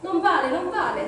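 loud, high-pitched protest as an angry Gollum; siseo indignado de Gollum. A narrator's reading. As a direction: protest loudly, high, like an outraged creature. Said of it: "sibilò".

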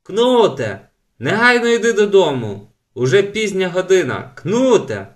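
loud, high call from afar; father calling out. A narrator's reading. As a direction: shout for him across the yard. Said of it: "волав з веранди батько". (батько гукає здалеку)

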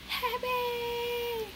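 high, crying wail of the word; hammed-up tearful squeal; mock happy wail. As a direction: cry it out like overjoyed tears. Repeats happy in a wailing cry.

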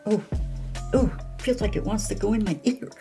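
ordinary talk, nothing marked

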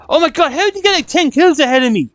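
high-pitched incredulous exclamation; astonished outburst. Real, not acted. Gamer finds a rival far ahead in kills, astonished. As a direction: cry out in disbelief at the gap.

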